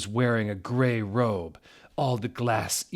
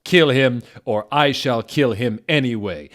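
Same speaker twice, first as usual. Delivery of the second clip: louder plain threat; stern threat; 3 semitones higher, 9 dB louder than usual. Acted. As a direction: bark the order. Villain threat first read.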